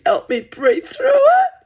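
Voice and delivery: high, loud laugh breaking through the words; cracking up while telling it; can barely get the words out for laughing. Real, not acted. Laughing at the absurd memory.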